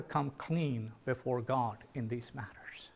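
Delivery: whispered, solemn and close; hushed whisper. Real, not acted. Preacher to his congregation, a solemn whisper. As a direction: drop to a whisper to draw them in.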